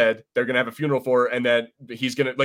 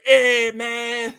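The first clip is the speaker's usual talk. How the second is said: put-on much higher stoner accent, drawn out; Cheech Marin impression; 10 semitones higher, 4 dB louder than usual. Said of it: "without it being like Cheech Marin".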